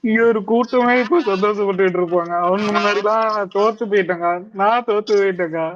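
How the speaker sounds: normal talking voice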